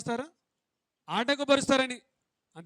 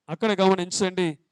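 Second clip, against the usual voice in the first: ordinary talk, nothing marked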